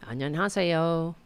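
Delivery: ordinary delivery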